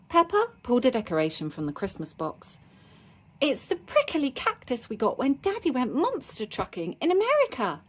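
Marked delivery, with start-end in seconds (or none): 3.4-7.8 put-on higher-pitched excited little girl; excited cry. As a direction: pipe up high with eager delight. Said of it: "she cried".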